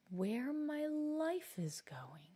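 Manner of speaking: slow, breathless whisper of someone just realising; slow awed whisper, barely voiced; stunned whisper. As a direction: whisper it to yourself as if the thought is brand new. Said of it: "She keeps muttering to herself".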